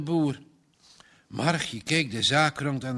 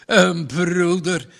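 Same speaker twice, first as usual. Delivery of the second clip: slow, louder, higher mocking repeat; scornful outburst; 4 semitones higher, 6 dB louder, slower than usual. Farmer throws Margje's word back, louder and mocking. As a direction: fling the word back at her.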